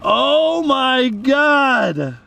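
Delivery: slow, stretched-out amazement; long, drawn-out astonished cry. Real, not acted. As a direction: draw out every word in disbelief. Sees the iPhone shot through.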